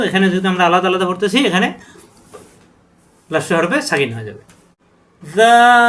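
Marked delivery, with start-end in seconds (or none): 5.2-5.9 জাল higher and louder, held out; soft stretched zal. Shown as a demonstration. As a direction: sound জাল softly and draw it out. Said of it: "নরম করে চার আলিফ টান". (জাল নরম করে টেনে)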